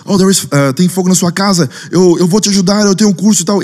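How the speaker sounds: acted-out neighbour rushing in to help; eager would-be rescuer. Neighbour offering help with a fire, urgent. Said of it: "chegou e falou assim".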